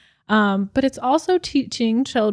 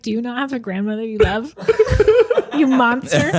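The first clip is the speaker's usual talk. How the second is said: laughing, mock-offended scolding; playfully indignant; mock outrage; 4 dB louder than usual. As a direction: call him a monster, half joking. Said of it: "And I was like".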